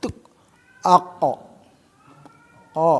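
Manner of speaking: short, bouncing "ko" with a clear echo; bouncing qaf demo. Shown as a demonstration. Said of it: "Tidak akan mungkin terjadi mantul kalau pem-, pengucapannya nggak kuat". (pantulan qaf yang jelas)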